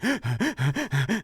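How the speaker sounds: sharp high gasp of panic; imitating a panicked gasp. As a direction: gasp for air like panic is hitting. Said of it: "having a panic attack in front of him".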